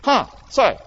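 ordinary talk, nothing marked

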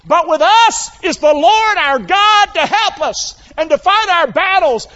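rally the troops like a king; rousing king's speech. Retold or imitated. Preacher voicing King Hezekiah rallying his people, proclaiming. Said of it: "look at what this king said".

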